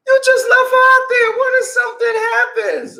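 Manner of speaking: very high, loud mock distress; imitated fragile girl. Girl in the back fearing something happens, high distressed imitation. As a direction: fret in an exaggerated falsetto. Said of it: "she was too fragile for that".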